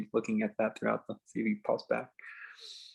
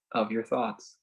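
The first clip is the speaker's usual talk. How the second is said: slow, spacious meditation guide with long pauses; calm phrases left hanging in silence; guided meditation; 3 dB quieter, slower than usual. Acted. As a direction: ease them into stillness, unhurried.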